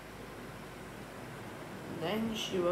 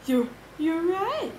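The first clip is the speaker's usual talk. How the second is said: high, louder character voice; cried out; 11 semitones higher, 6 dB louder than usual. A narrator's reading. Miss Anthrope agreeing it is a dream, crying out high. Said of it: "cried Miss Anthrope".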